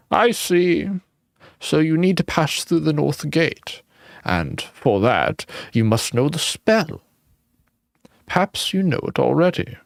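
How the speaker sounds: put-on high old man's voice. Voicing the old man.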